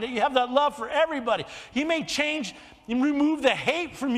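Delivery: fervent preaching. Real, not acted. Preacher urges congregation to trust God, fervent and loud. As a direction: preach it out with building force.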